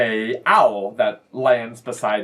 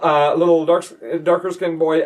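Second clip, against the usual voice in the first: ordinary talk, nothing marked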